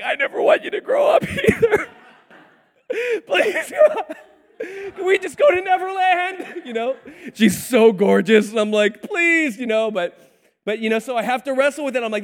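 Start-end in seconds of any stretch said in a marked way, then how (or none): 0.0-6.6 very high, squeaky hammed-up begging; mock tearful pleading. Dad begging daughter to stay little, mock tearful squeak. 9.0-9.6 high-pitched exaggerated begging; mock pleading. Dad begging his little girl, mock pleading.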